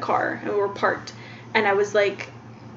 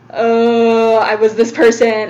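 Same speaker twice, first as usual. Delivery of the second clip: drawn-out, louder groan of recognition; retold dismayed realisation; 8 dB louder, much slower than usual. Realising she was the yeller, retold with dismay. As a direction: retell a slow, loud "oh" of self-disgust. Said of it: "it made me feel so gross".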